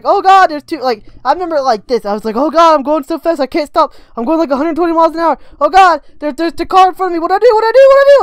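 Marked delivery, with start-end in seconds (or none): 0.0-0.8 high, loud panicked gasp; kid panicking. Retold or imitated. Young self seeing two cars ahead, panicked higher and louder. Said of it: "what mind did a seven-year-old is like". 2.3-8.2 put-on the boy losing control, voice climbing with every cry; panicking to frantic. Boy on a runaway bike facing a car, panic rising to frantic yells. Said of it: "And I freaked out".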